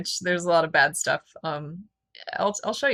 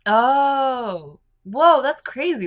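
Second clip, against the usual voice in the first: surprised; 7 semitones higher, 6 dB louder, much slower than usual. Real, not acted. Reacting to rude meaning.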